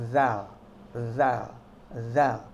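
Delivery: "zal" as gently buzzed th; tongue resting between teeth on "zal"; repeated fine-buzzed "zal"; soft dental Z. Shown as a demonstration. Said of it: "Semakin halus".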